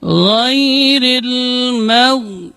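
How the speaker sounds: "Ghairil mau" chanted, the r kept light; "Ghairil" drawn out with a light r; thin ra. Shown as a demonstration. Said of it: "dibaca tipis karena berbaris kasrah".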